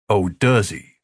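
ordinary delivery, nothing marked

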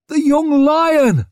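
high, loud crowd cheer; cheering shout. A narrator's reading. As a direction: cheer him on wildly.

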